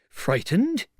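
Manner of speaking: high, rising indignant question; incredulous echo. Acted. Tramp to the unseen voice, incredulous. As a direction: throw the word back high, in disbelief.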